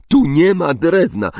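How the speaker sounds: high, loud mimicry of her cry; exaggerated squeal of mock panic; mocking imitation. A narrator's reading. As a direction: parrot the panicked line to tease. Ron mockingly quotes Hermione's panicked cry.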